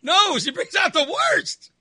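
high-pitched indignant correction; emphatic contradiction. Real, not acted. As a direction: protest with the voice jumping right up. Contradicting co-host's remark.

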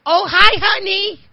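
high, breezy sing-out greeting; hammed-up mom, not the least bit rattled; mock-casual high-pitched hello; unrattled mother. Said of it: "She's not the least bit rattled".

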